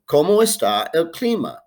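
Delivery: "¿Cómo está el clima?" said in clear Spanish, stress on CÓMO; pronunciación modelo; Spanish model phrase. Shown as a demonstration.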